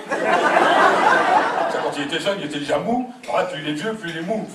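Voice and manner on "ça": laughing squeal. Acted. Laughing jab at father.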